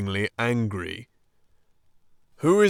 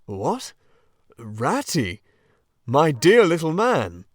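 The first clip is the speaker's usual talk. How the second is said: louder, welcoming old badger; kindly Badger; 3 semitones higher, 6 dB louder than usual. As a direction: fuss over them warmly. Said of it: "in quite a different voice".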